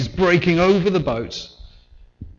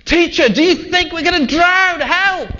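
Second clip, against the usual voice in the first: high, loud panicked shout; terrified fishermen yelling at their sleeping teacher; panicked cry; 9 dB louder than usual. Acted. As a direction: scream for help as the boat sinks. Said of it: "That's where they were coming at it".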